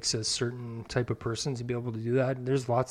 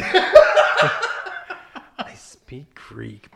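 slow, louder, drawn out through laughter; incredulous repeat, laughing through every word; 3 semitones higher, 8 dB louder, much slower than usual. Real, not acted. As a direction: say it while cracking up at the joke. Repeating the phrase while cracking up.

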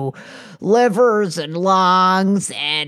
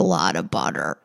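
much lower, fast boastful rumble; deep voice; 12 semitones lower, 4 dB quieter than usual. Acted. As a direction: drop the voice way down. Tycoon character dropping voice low.

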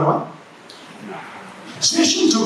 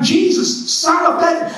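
1 crying out to Jesus; 9 semitones higher than usual. Retold or imitated. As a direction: raise "Jesus" high, a beggar's desperate call. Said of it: "So he starts by going".

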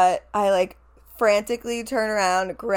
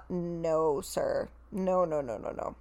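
no after no, dropping much softer; a firm no that fades into a mutter; fading refusal; 3 semitones lower, 7 dB quieter than usual. Real, not acted. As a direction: shake it off, fading to almost nothing. Emphatic refusal trailing off.

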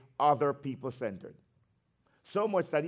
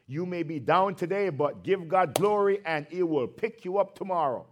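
ordinary delivery

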